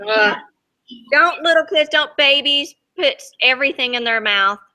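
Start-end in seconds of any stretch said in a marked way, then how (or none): none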